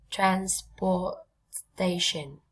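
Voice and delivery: "transportation" very slowly, split into separate syllables. Shown as a demonstration. Teacher models long word by syllables. (đọc thật chậm "transportation", tách từng âm tiết)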